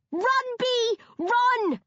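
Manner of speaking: high, loud frantic roar. A narrator's reading. Ingrid roars at Bea from upstairs, panicked yell. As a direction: scream the warning at full force. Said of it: "she roars at me".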